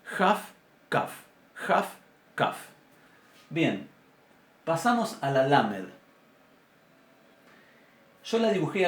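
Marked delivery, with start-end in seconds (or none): 0.0-2.7 "Jaf" fricativa frente a "kaf" oclusiva. Shown as a demonstration. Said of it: "y la segunda ka". ("Jaf" fricativa, "kaf" oclusiva)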